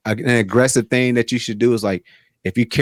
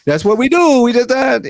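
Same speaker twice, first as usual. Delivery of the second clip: deliberately bad Italian accent, higher and louder; hammed-up Italian impression; a mock Italian voice done badly on purpose; 10 semitones higher, 3 dB louder than usual. Shown as a demonstration. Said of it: "Here, let me do a bad one".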